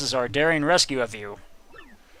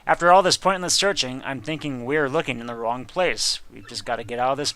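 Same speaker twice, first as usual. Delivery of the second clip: usual speaking voice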